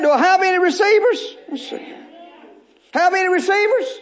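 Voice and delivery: high, loud call to the crowd; rally the congregation, grinning; laughing call for receivers; rousing call out. Real, not acted. Preacher calling to congregation.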